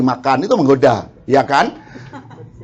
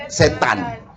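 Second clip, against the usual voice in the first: ordinary delivery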